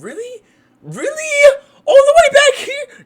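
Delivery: squealing outraged yell; shocked shout. Real, not acted. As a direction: scream it like you can't believe it. Mocking a guest's hairline.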